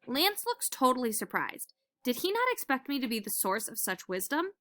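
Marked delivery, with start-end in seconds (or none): none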